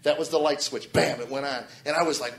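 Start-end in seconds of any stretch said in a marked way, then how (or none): none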